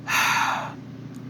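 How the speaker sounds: a soft breathy sigh; resigned sigh. Acted. Goku giving in to King Kai, with a sigh. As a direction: let out a heavy sigh of giving in. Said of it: "Goku sighs and says".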